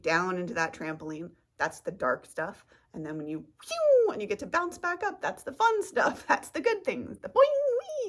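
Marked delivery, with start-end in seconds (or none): 3.6-7.2 higher, louder and bouncy; gleeful bounce upward; playful excitement. Acted. 7.2-8.1 very high sung boing; cartoon boing; boing sound. Shown as a demonstration. Imitating trampoline bounce.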